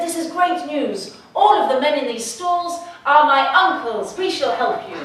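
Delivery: hint of an Italian accent, beaming; young man promising help in an Italian accent. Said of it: "That's Italian".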